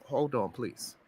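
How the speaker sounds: soft and tired, asking for the floor; quiet hold on. Real, not acted.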